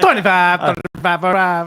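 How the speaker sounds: loud, laughing auctioneer rattle; auctioneer impression. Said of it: "You sounded like a auctioneer".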